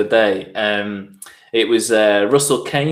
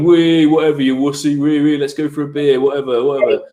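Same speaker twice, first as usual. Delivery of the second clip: mocking lads; 4 semitones higher than usual. Lads in the WhatsApp group jeering at a mate. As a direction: jeer like the lads brushing it off.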